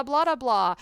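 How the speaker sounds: ordinary talk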